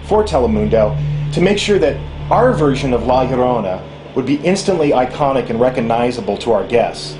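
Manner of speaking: ordinary talk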